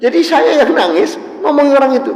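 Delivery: high and loud, voice full of feeling; emotional crying speech. Retold or imitated. Preacher recalls weeping while scolding the son, emotional. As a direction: tell it as if tears are coming. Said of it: "Terus saya nangis".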